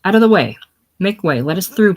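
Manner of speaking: loud, commanding order to clear the way; imperious. Acted. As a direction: push people aside with your voice.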